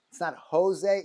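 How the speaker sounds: Jose said the English way, ho-ZAY; Jose with an English h and long ay; wrong English Jose. Shown as a demonstration. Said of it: "it's Jose".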